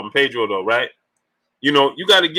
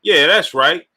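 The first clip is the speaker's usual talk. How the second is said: usual speaking voice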